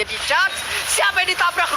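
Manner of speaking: high excited call; excited shout. Real, not acted. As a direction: shout it out, thrilled. Caster calls out charge.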